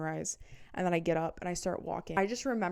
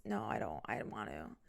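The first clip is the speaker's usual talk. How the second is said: low, quiet reluctant mumble; low mumbled denial; 8 semitones lower, 7 dB quieter than usual. Retold or imitated.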